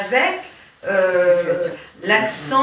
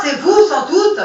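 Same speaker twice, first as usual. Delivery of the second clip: laughing; 4 semitones higher, 8 dB louder, slower than usual. Real, not acted. Laughs mid-reading.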